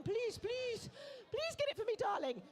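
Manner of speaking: high, pleading please please; pleading wife. Retold or imitated. Wife begging her husband to buy the painting, high pleading voice. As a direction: beg sweetly like a wife wanting the painting. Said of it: "She's going".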